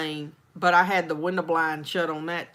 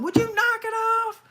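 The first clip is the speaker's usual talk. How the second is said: much higher mock protest; laughing protest. Retelling how she begged her friend to stop, high protest. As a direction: shriek it through laughter, imitating herself. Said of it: "slapping the table going".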